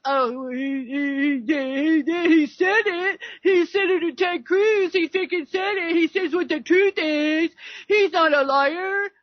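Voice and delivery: lightly voiced protesting roommate; mock earnest insistence; imitating the roommate. Retold roommate defending the speaker.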